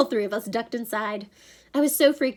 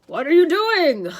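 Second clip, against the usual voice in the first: slow, loud, higher-pitched monster demand; 9 semitones higher, 10 dB louder than usual. A narrator's reading. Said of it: "the monster demanded".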